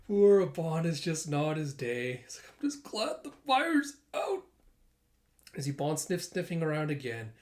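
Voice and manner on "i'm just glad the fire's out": holding back laughter. Retold or imitated.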